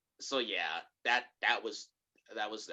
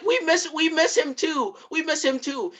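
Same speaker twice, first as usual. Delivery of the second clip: high, raised, pleading voice; mock desperate protest; 5 dB louder than usual. Family to grieving mother, retold high pleading protest. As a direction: imitate upset relatives insisting. Voicing relatives pleading in quarrel.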